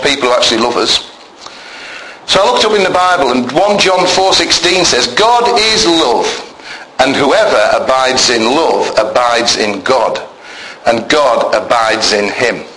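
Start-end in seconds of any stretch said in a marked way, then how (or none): 5.1-12.6 scripture reading. Acted. Reads verse aloud in church.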